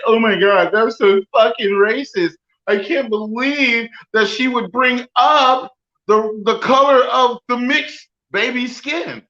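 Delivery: high, slowed put-on voice of offended people; mock outraged crowd. A podcaster imitating the outraged public, mock shocked. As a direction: pitch it up like a scandalized onlooker. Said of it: "if the masses of people say".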